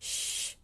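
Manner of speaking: "Sh" softly held, all breath; hushing SH. Shown as a demonstration. Teacher likens the letter to hushing, soft SH. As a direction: shush with "Sh" as if calling for quiet. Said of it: "Quiet, quiet".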